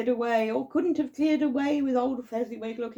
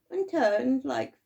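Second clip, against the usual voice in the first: usual speaking voice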